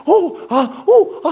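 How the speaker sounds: high, loud oohs and aahs; crowd gasps. Retold or imitated. A preacher mimicking a crowd watching a tightrope walker, high oohs and aahs. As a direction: gasp like a nervous crowd. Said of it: "하면서 막 환호를".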